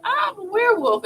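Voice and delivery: high-pitched mock werewolf announcement; playful, high werewolf voice; little werewolf. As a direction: burst out as a small storybook werewolf. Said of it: "little werewolf comes out and he's like".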